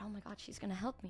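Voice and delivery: fast, breathy whispered aside; mock awed whisper. Teller mocking her own awe at the psychic, whispered fast.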